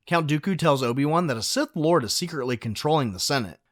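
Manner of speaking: ordinary talk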